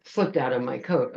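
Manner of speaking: ordinary delivery, nothing marked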